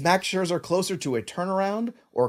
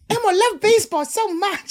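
high squeaky puppet voice, giggling first; gleeful little red monster; squeaky Elmo gushing; Elmo impression; 4 dB louder than usual. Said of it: "Your impressions get me every".

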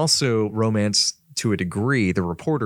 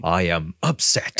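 mock upset; 6 semitones lower, much slower than usual. Mock reaction to being scorned.

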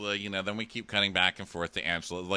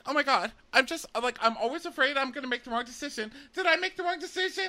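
much higher, wailing indecision; whiny mock crying. Mocking the sobbing girl to the counselor, high and whiny. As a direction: ham up a tearful girl. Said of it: "And she's like".

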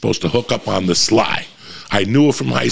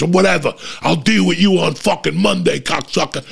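higher-pitched acted threat; retold angry yelling; 7 semitones higher than usual. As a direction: play the jealous boyfriend mouthing off. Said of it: "he's yelling at the girl".

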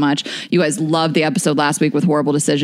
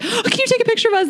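eager fan; faster than usual. Retold or imitated. Fan imitated asking for photo.

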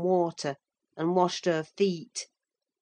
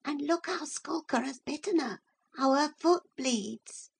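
put-on high young boy voice; 9 semitones higher, 4 dB quieter than usual. Boy continuing to mother.

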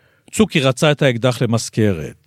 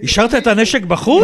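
surprised question; 11 semitones higher than usual. Real, not acted. Interviewer asks the veteran in disbelief, surprised. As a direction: pitch leaps up in disbelief. Interviewer shocked gun left outside.

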